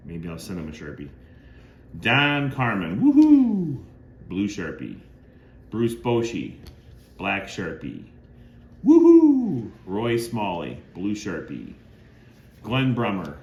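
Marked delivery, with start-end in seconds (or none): 3.0-3.8 much higher, much louder whoop; excited woohoo. Real, not acted. Collector cheering a blue Sharpie autograph, excited high loud whoop. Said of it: "gonna get a little excited". 8.8-9.7 much higher, much louder whoop; excited woohoo. Real, not acted. Collector cheering a blue Sharpie autograph, excited high loud whoop. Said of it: "gonna get a little excited".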